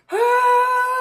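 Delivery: shrill high mock scream; high imitated shriek. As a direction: scream like a panicked friend. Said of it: "Junior was screaming".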